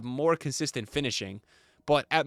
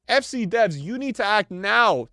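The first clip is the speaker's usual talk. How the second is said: raised, emphatic read of an angry comment; mock outraged demand; 6 semitones higher, 5 dB louder, slower than usual. Streamer reads a fan's demand to the devs, mock outraged. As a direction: hit it hard, like an irate fan.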